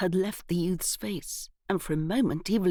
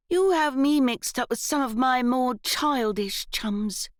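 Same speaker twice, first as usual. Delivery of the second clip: sulky; 6 semitones higher, 3 dB louder than usual. A narrator's reading. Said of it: "he said sulkily".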